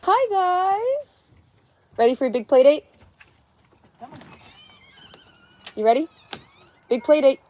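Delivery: high, loud, excited hype-up; excited cooing. Real, not acted. Hyping someone up for a big play date, excited cooing.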